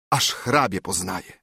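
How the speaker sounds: plain reading voice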